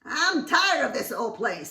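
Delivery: loud, fed-up wife bird; exasperated nagging; loud complaint. Acted. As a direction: grumble it at full volume.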